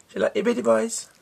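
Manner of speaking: squeaky sing-song to a pet. Real, not acted. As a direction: ask it up high and sweet. Baby talk to the dog.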